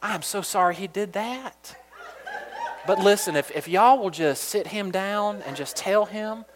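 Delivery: soft, slow, sweet Georgia drawl; a touch of Georgia twang, coaxing the daycare lady. Shown as a demonstration. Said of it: "just put a little bit of that Georgia in there".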